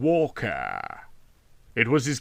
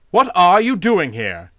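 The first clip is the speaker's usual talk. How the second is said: a sharp, louder demand; 6 semitones higher, 6 dB louder than usual. A narrator's reading. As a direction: challenge the stranger firmly. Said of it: "he demanded".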